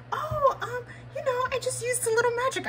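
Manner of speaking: high, fast, giggly princess voice; playing along. Answering the cast member as a princess, high and giggly. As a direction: play the princess, flustered and delighted. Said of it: "I was like".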